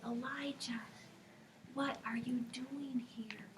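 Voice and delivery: soft whispered question; gentle whisper. Acted. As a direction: whisper kindly. God whispers to Elijah.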